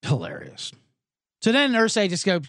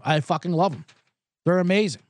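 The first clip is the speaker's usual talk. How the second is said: ordinary talk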